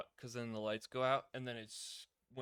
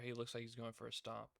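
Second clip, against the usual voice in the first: usual speaking voice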